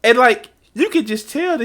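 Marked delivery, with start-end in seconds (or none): none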